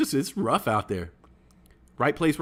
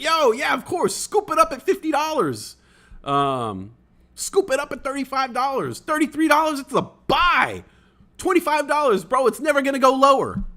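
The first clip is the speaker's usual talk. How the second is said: much higher, louder mock hype-man voice; hyped-up past self; 12 semitones higher, 4 dB louder than usual. Streamer mimics his old self hyping the stock to followers, mock-excited high voice. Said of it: "And I was like".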